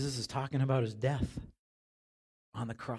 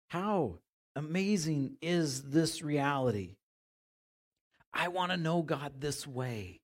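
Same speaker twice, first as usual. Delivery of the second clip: ordinary delivery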